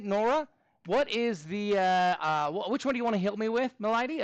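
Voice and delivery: put-on playful Australian accent, asking a student which problem; a hammed-up Australian twang on the question. Teacher calls on Nora in a mock Australian accent. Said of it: "in an Australian accent".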